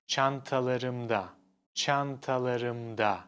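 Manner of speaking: çantalarımda said slowly and clearly, syllable by syllable; slow Turkish word. Shown as a demonstration. Teacher modelling çantalarımda slowly for students. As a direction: spell out çantalarımda slowly for learners. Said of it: "we say".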